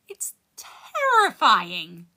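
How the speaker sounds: very slow, breaking with tears; tearful. Acted. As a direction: let the voice crack on it. Poem ends in tears.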